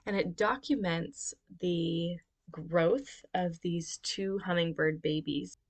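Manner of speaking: ordinary delivery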